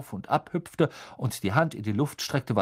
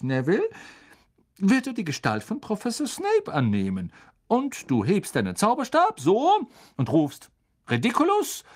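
kind teacher; 6 semitones higher than usual. A narrator's reading. Teacher explaining the spell to the class, higher and friendly. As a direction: explain patiently like a gentle teacher. Lupin voiced.

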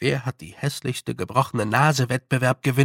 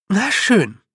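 put-on higher, louder girl's voice; brisk acceptance, taking charge; firm and high, getting down to business; determined young girl; 7 semitones higher, 6 dB louder than usual. A narrator's reading. Tiffany takes charge.

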